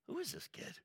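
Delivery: high, hushed amazed question; astonished onlooker asking; amazed crowd. Retold or imitated. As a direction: marvel at the boy. Crowd amazed at boy Jesus.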